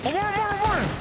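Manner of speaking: very high excited cheer; excited high shout. Real, not acted. Teammate near clutch win.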